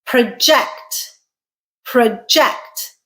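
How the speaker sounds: "Project" as pruh-JECT; stress on second syllable. Shown as a demonstration. Teacher introduces the verb, "Project" stressed on JECT. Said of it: "Second syllable stressed is a verb".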